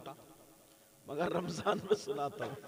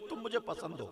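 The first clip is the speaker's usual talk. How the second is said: ordinary delivery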